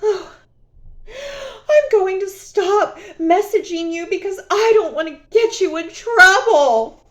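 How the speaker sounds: high, whiny fake-innocent voice; mock sweet mistress. Mocking the mistress's fake concern, whiny and high. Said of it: "she says to him".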